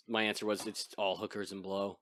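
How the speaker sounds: ordinary talk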